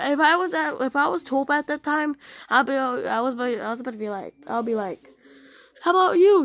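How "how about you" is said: loud, high imagined retort; mock outraged shout; angry comeback. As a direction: fire it back at the bully, acted.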